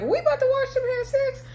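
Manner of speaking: high, startled mock gasp of a question; shocked disbelief. Candace reacting to the ceremony invite, high and shocked. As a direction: squeal it like you can't believe what's coming. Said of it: "And Candace is like".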